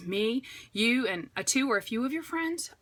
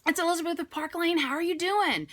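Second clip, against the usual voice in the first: higher, friendly phone greeting; phone call greeting; 7 semitones higher than usual. Shown as a demonstration. Consultant demoing her opening call to Jane, friendly and higher. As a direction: greet her like she just picked up. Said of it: "I'm gonna be like".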